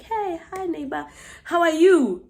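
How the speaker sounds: ordinary talk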